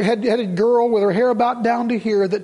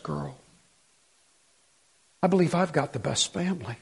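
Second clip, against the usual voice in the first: hushed, low thankful prayer voice; quiet grateful prayer; 6 semitones lower, 6 dB quieter than usual. Retold or imitated. Preacher voicing his own prayer, hushed and low. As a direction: murmur thanks to God, soft and low.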